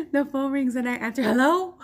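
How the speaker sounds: a bright high eager hello; relieved shout into the phone; excited. Retold or imitated. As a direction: answer in relief, loudly.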